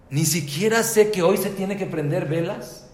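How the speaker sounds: loud, high self-reproach in a retold story; remorseful outburst. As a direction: cry out in dismay at yourself. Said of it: "le agarró un remordimiento".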